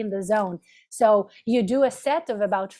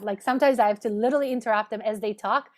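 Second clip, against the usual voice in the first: ordinary talk, nothing marked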